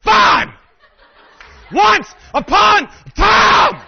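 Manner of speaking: furious high scream, drawn out; screaming daughter. Retold or imitated. Daughter giving in to dad's nagging, screaming. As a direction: scream it word by word in a tantrum. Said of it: "screaming at her dad".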